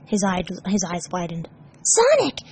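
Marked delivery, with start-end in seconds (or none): 1.8-2.4 high, loud, amazed; overwhelmed with joy; high, excited gasp of wonder; gasping delight. Acted. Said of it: "he gasped".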